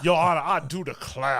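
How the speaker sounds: put-on honeyed courtroom Southern accent; southern lawyer accent. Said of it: "my Southern lawyer impression".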